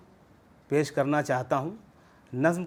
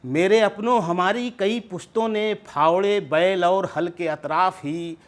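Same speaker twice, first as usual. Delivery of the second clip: measured nazm recital with raised opening calls; poetry recitation; 5 dB louder than usual. Acted. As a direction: recite verse to an audience, falling away at the close. Recites his poem at mushaira.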